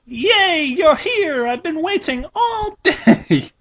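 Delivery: high, bouncy Pinkie Pie voice; excited pony voice. Acted. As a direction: squeal it like a giddy cartoon pony. Reading Pinkie Pie's excited lines.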